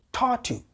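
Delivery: mock-puzzled high "Tatu"; mispronounced name. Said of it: "my name not being my name".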